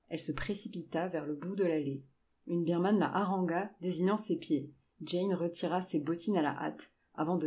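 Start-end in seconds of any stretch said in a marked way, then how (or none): none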